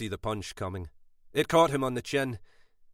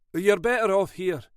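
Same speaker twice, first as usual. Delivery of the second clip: firm, louder husband insisting; firmer tone; 10 semitones higher, 6 dB louder than usual. A narrator's reading. Husband overruling his wife, firm and louder. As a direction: lay down the law to her. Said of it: "Derek's voice took on a firmer tone".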